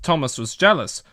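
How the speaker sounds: usual speaking voice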